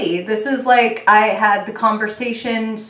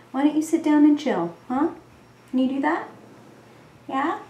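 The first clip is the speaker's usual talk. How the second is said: coaxing the cat; 7 semitones higher than usual. Real, not acted. Talking to her senior cat in high baby talk.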